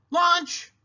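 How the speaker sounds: high, loud call. A narrator's reading. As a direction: call him to the table. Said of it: "Uncle Bill called".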